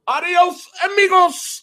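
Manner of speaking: high goblin goodbye; high mock farewell. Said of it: "And he goes".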